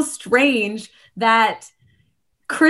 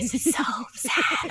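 mock sad hush; 9 dB quieter than usual. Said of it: "I'm like".

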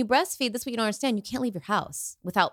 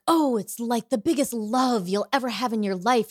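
imitating everyone gushing, a bit higher at the start; mock gushing; 4 semitones higher than usual. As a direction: gush it like a well-meaning cliché. Mimics everyone gushing about love.

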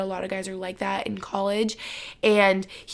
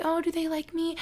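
much higher and softer, a small unsure voice; anxious wondering; 8 semitones higher, 6 dB quieter than usual. Retold or imitated. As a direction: ask it timidly in a high voice. Imitating anxious uncertainty.

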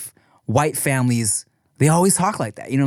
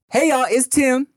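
promo announcement; 10 semitones higher than usual. Real, not acted. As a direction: announce yourself big and high. Recorded podcast promo opening.

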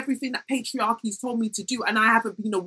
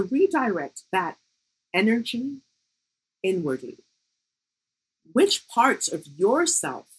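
therapist voice. Pretend. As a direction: gently guide them to reflect, slowly. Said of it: "my therapist voice".

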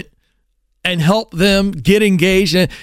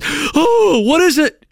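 high, startled oh, what is it; alarmed question; 10 semitones higher, much slower than usual. Retold or imitated. Parent seeing the blob in the water, high startled alarm. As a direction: jolt up in sudden alarm. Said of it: "your immediate reaction is".

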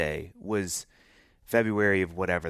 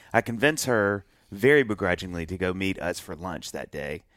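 usual speaking voice

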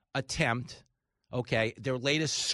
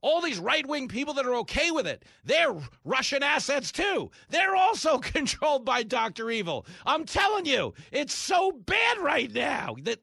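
much higher, frantic mock voice; hysterical media; 9 semitones higher, 4 dB louder than usual. Host continuing to mock media hysteria, high. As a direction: rant like a panicked pundit. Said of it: "that's what they told us".

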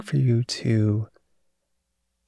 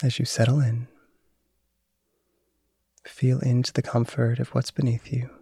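guided meditation. Acted. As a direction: calmly guide awareness of thought. Leads a mindfulness meditation.

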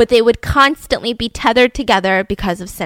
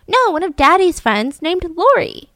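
put-on higher, innocent kid correcting Mom; imitated child; 6 semitones higher than usual. Child innocently tells Mom about Daddy's friend, imitated kid voice. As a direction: lightly play a guileless child. Child answers mom's question.

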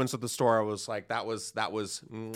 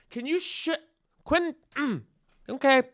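high, meek stammering request; starstruck, high-pitched backing down; flustered backpedal. Retold or imitated. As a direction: lose your nerve mid-sentence. Timid request to Tarantino.

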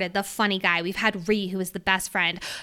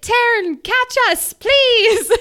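much higher, louder call for help; 12 semitones higher, 7 dB louder, slower than usual. Retold or imitated. Violet calling to the dragon while falling, high and loud. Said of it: "And Violet's like".

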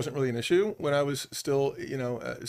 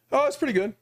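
lukewarm pretty good; 9 semitones higher than usual. Retold or imitated. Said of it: "I'd be like".

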